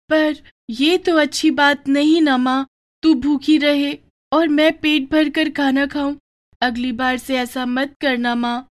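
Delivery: put-on a young girl soothing her crying mother; little daughter consoling. Daughter scolds mother gently.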